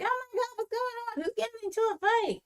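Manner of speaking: very high, fast imitation; mock panic in a squeaky-high rush; alarmed friend. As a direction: act out a friend's flustered questions. Imitates friend alarmed about a fight.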